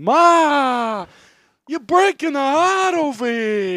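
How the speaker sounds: loud, high, drawn-out mock wail; hammed-up wailing Italian mother; mock crying mom. Mom wails at her son over a forgotten dish, mock crying. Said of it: "his mom starts crying".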